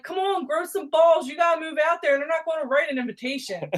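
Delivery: high, loud, impatient yell at a driver; road rage rant. Retold or imitated. Yelling at a hesitant driver, high loud road rage. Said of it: "John makes fun of me nonstop".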